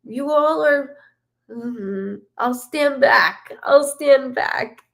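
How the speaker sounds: lightly voiced father, pleading then stepping back; a hint of the father talking to his daughters; voicing the late father. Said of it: "He goes".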